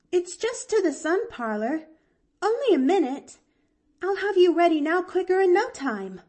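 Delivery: put-on high, loud eager child; panting eagerness. A narrator's reading. Girl tugging her aunt along, panting and eager. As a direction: urge her on in a high, breathless rush. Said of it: "panted Pollyanna".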